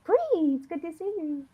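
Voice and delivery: high, soft creepy greeting; small high voice, sliding down. Acted. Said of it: "that was a creepy voice".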